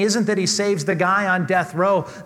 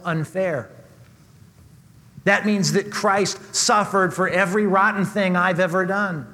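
ordinary delivery

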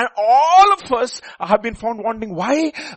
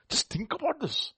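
whispered aside; 6 semitones higher, 12 dB quieter than usual. Real, not acted. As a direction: drop to a whisper.

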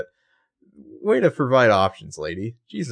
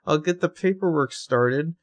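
chirpy nurse; 9 semitones higher than usual. Retold or imitated. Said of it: "she's like".

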